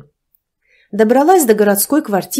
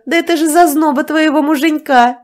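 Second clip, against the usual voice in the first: higher, louder, a jeering spiteful voice; spiteful laugh; 8 semitones higher, 5 dB louder than usual. A narrator's reading. Neighbour exposing the husband's mistress, spiteful jeer. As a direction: sneer it out with a nasty laugh. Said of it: "злобно рассмеялась соседка".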